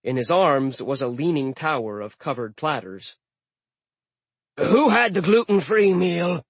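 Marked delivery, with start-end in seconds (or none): 4.6-6.4 loud, high growled question; a grumpy chef calling orders; growling chef. Acted. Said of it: "he growled".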